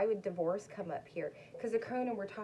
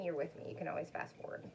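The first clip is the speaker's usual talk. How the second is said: usual speaking voice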